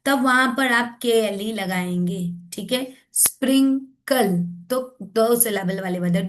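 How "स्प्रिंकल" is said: syllable by syllable. Shown as a demonstration. Teacher showing chin-touch syllable count, sprinkle syllable by syllable. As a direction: say sprinkle in two separated syllables. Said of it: "दो बार skin, जो है, चिन touch".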